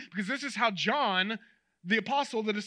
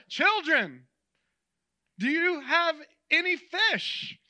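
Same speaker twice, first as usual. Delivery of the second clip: higher, louder, drawn-out call; yelling across water; 6 semitones higher, 4 dB louder, slower than usual. Retold or imitated. Jesus on the shore hails the disciples, yelling across water. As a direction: call out to carry over distance. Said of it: "he yells across the water".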